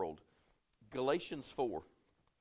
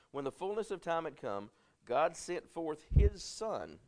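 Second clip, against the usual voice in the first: slow, raised, weighty reading; slow scripture reading; 4 semitones higher, slower than usual. Acted. Preacher dwelling on the verse for the congregation, slow and weighty. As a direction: lay each phrase down slowly. Reads scripture, second take slower.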